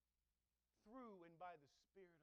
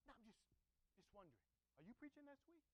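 higher, softer, casual probing voice; nosy church member; 5 semitones higher, 7 dB quieter, faster than usual. Retold or imitated. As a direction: fish for information while sounding friendly. Church member pressing the question.

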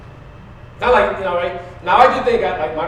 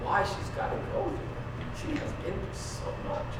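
hushed weeping plea to God; whispered tearful prayer; 8 dB quieter than usual. Retold or imitated. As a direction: whisper it through tears. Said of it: "I'm like".

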